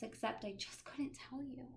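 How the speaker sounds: whispered confession; guilty whisper. Acted. Child admitting the hidden mouse swap, whispered. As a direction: hush it like a secret.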